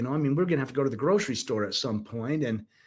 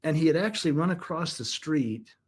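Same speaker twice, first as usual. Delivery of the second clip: ordinary talk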